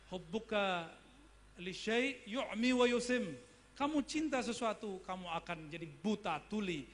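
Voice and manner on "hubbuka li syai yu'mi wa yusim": slow, raised Arabic recital; hadith recitation. Acted. Preacher quotes the hadith to the congregation, slow intoned recital.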